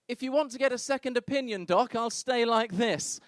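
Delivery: high-pitched, lightly voiced woman; patient behind curtain. Wife behind the curtain to doctor, high-pitched offer. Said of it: "She's behind the curtain like that".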